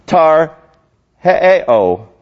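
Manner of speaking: Tarheo broken into separate, careful pieces; effortful pronunciation. Shown as a demonstration. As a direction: work through Tarheo haltingly, with a gap. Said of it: "I have trouble pronouncing that".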